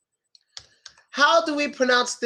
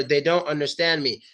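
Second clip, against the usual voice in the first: ordinary talk, nothing marked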